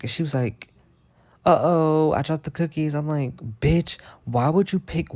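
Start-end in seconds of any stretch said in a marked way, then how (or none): 1.4-2.9 higher, louder mock "uh-oh" voice; mocking coworker. Mimicking coworker who dropped cookies. 3.6-5.1 higher, slow reenacted outrage; indignant confrontation. Retold confrontation over dropped cookies.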